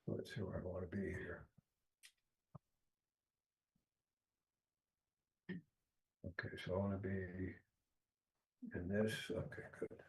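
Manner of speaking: very soft, absent self-talk; talking to oneself. Real, not acted. Presenter finding the right place to begin, talking quietly to self. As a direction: think aloud under your breath.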